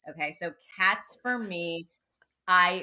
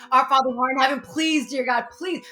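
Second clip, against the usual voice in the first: louder, higher, desperate mock prayer; frantic retold prayer; 5 semitones higher, 8 dB louder, faster than usual. Terrified in a Santorini alley, praying at a stray cat, loud and high. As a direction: pray fast in a panic, half-joking. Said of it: "praying, doing the Our Father".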